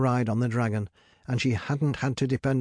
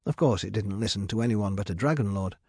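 ordinary delivery, nothing marked

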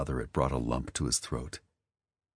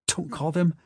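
hiccuping; 14 semitones higher than usual. A narrator's reading. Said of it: "Wesley hiccuped".

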